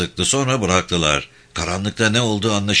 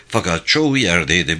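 usual speaking voice

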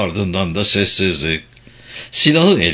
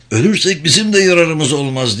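higher, loud defiant shout; 5 semitones higher, 3 dB louder than usual. A narrator's reading. Marine sergeant shouts back at Dietz, defiant. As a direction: yell it back at the captain. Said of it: "diye bağırdı denizci çavuş".

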